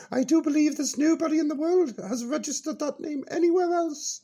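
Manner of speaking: put-on high, bright-eyed old dear reminiscing; high character voice Peachy. Old character reminiscing to the radio host, high voice. Peachy explaining her granted name.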